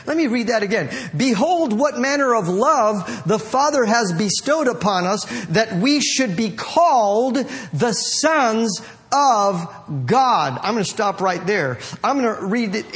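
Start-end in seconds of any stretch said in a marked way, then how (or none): 1.2-10.6 declaimed verse, slowing into the last phrase; scripture reading. Acted. Preacher repeats a verse to the congregation, solemn proclamation. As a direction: proclaim it grandly to the room.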